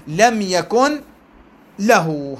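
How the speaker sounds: "لم يكن", a clear stop, then "له"; pause before له. Shown as a demonstration. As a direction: break after "يكن" with the n sounded. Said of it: "هذا وقف".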